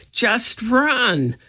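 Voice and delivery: high, urgent command; urgent cry. A narrator's reading. Annie hurries a confused Jack away, crying out. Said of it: "cried Annie".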